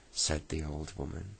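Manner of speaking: ordinary delivery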